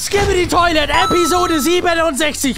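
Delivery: booming show announcer. Acted. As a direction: announce it like a big event. Hype intro for the episode.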